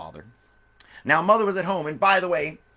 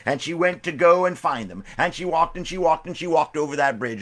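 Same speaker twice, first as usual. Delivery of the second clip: ordinary delivery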